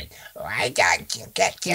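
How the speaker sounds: a hint of a creepy internet ghoul; Momo voice. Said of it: "I don't think she talks like that".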